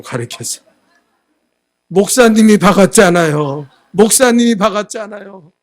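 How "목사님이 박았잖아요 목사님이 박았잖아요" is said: put-on a loud wailing child's blurt, pitch rising on the repeat; crying child's shout. Crying child yells at the pastor, loud wailing accusation. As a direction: wail it like a sobbing kid pointing at the pastor. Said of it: "울상이 되어서 엉엉 울면서".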